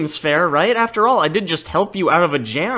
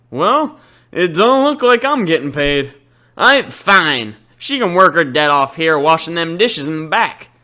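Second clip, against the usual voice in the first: gruff Southern shopkeeper, a little louder; Southern accent; 4 dB louder than usual. Acted. Southern chef grudgingly sets the debt terms, Southern accent. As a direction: grumble it out like a Southern diner owner. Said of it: "giving him, like, a Southern accent".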